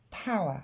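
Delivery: power as one glided paa; English power. Shown as a demonstration. Teacher models the right power after the wrong split. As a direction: power smooth, ow merged, no r. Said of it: "Encore une fois".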